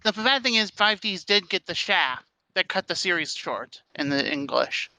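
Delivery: ordinary talk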